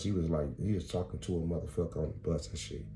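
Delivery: ordinary talk, nothing marked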